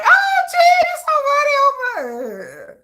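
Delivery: high, stretched out gushing; gushing fans. Retold or imitated. Fans reacting to Jin's joke, high and gushing. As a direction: swoon over him like an adoring fan. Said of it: "And people be like".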